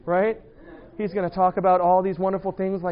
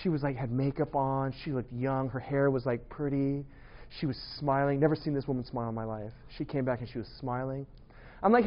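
ordinary talk, nothing marked

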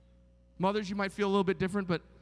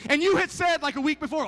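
usual speaking voice

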